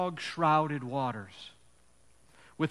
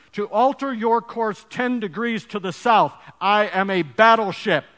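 loud angry threat, higher pitch; growling captain; 5 semitones higher, 11 dB louder than usual. Acted. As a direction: threaten him with all the captain's rank. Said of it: "the captain growled back over the radio".

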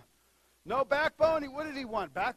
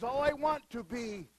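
ordinary delivery

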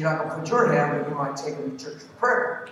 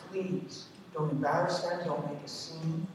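usual speaking voice

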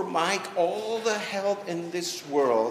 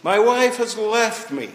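high and loud, still bellowing; loud daytime voice; 6 semitones higher, 8 dB louder than usual. Retold or imitated. Caller tells pastor his wife left, loud and high. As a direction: blurt it out at full daytime volume. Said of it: "in his twelve o'clock noon voice".

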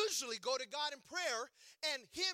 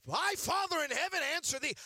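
hammed-up prophet blessing; prophet of the Lord; 7 semitones higher, 6 dB louder than usual. Pretend prophet pronouncing to his son, grandly. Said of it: "as the prophet of the Lord".

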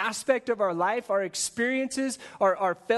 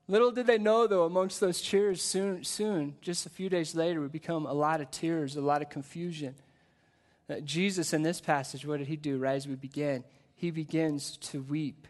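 usual speaking voice

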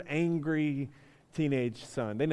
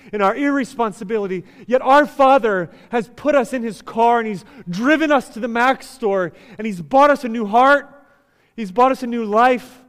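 loud, raised, driving sermon delivery; impassioned preaching; 6 semitones higher, 11 dB louder than usual. Real, not acted. Preacher applying the iPad story to grace, impassioned preaching. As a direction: build urgent conviction for the congregation.

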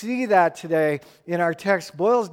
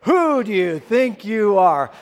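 scornful challenge, pressed louder; derogatory; 3 semitones higher, 6 dB louder than usual. Shown as a demonstration. Pastor models a derogatory tone for the congregation. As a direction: sneer the question down at them. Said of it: "like, really derogatory?".

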